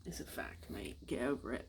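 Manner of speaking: put-on quiet, tight British-accent aside; mock British accent, dropping almost to a murmur. American host joking about British stiffness, put-on British accent. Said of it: "when I do that accent".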